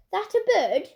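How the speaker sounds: startled question. Acted. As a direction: blurt it out, taken aback. Driver startled by parrot.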